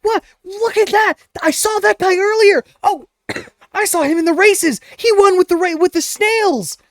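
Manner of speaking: high, excited crowd voices; a carnival crowd member squealing with delight at the winner; cheering crowd. Acted. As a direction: gush like an amazed fan in the stands. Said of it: "you hear the crowd cheering out".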